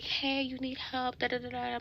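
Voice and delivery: lightly imitating an overly helpful clerk; mock sales associate. Store clerk hovering over her, mildly mocked sales-associate voice.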